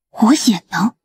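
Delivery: half-whispered wondering; hopeful musing. Acted. Musing alone on winning the cousin's acceptance, whispered wonder. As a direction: let it slip out as a private whisper.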